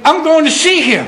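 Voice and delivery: imitating an excited friend, pitched well up. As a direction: burst out with delight at seeing him. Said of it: "he said it with excitement in his voice".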